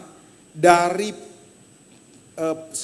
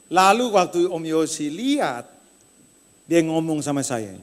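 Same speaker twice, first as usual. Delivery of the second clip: ordinary talk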